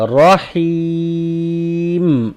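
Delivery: رحیم stretched very long with a falling tune; lengthened stop at the verse end; long ending demo. Shown as a demonstration. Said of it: "ہم اس کو لمبا پڑھتے ہیں".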